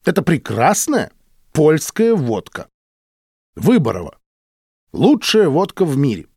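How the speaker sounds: a touch of wounded pride; offended. A narrator's reading. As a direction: praise it emphatically, piece by piece. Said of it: "обиженно сказал доктор".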